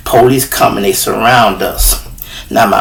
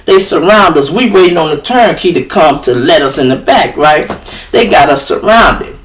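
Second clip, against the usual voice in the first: ordinary delivery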